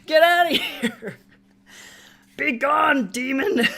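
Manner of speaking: high, loud, hammed-up exorcism; playful shout of banishment; mock banishing. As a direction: cast them out like a demon, laughing. Shooing away freeloaders, laughing.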